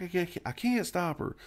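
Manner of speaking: high, helpless voice, a touch of fear; panicked reply. Retold or imitated. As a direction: say it as if you can't cope. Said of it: "She's like".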